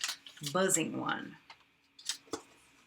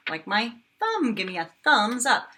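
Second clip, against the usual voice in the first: ordinary delivery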